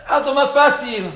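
high, loud whiny plea; mock pleading. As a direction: ham up the needy prayer. Súplica exagerada y burlona. (súplica exagerada en broma)

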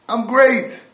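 loud, high, happy yell from below; cheerful shout up. Retold or imitated. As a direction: call up cheerfully from the pit. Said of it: "The guy screams up".

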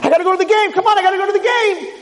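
high, frantic pleading; urgent insistence. Acted. Injured player insists on leaving.

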